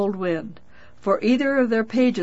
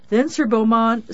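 ordinary delivery, nothing marked

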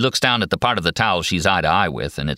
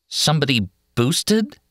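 puzzled; 8 semitones higher than usual. A narrator's reading. Nurse puzzled by slang.

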